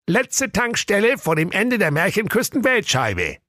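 high put-on voice reading the sign. A narrator's reading. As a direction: read the sign aloud in a high funny voice. Schild wird vorgelesen. (hoher Vorlesestimme für das Schild)

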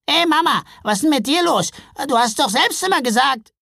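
high, loud troll youngster; protesting troll sons. A narrator's reading. Troll sons challenging their mother, high and protesting.

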